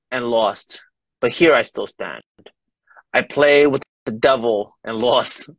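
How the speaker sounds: slow, steady poetry reading; poem reading. Acted. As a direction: read it like a defiant poem. Reads poem aloud.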